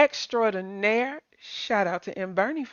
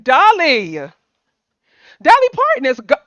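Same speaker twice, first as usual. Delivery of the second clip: much higher and louder, bursting out the name; excited outburst; 4 semitones higher, 10 dB louder than usual. Real, not acted. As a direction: blurt it out, overcome with the news. Breaking news of Dolly's death.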